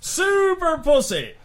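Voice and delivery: high, drawn-out holler; yelled punchline callback. Acted. Comic calling back the flashing old lady's line, yelling. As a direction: stretch the words in a high yell. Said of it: "flashes open her robe and yells".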